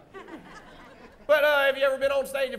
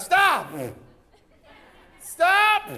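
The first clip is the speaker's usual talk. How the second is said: loud, high commanding shout; hero's yell; 8 semitones higher, 5 dB louder than usual. Shown as a demonstration. Hero pointing gun at the villain, loud high yell. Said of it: "yell out".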